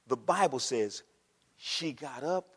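normal talking voice

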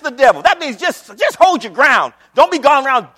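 loud, high, fired-up pulpit delivery; exultant preacher at full volume; full-force preaching. Acted. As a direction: shout the good news to the church.

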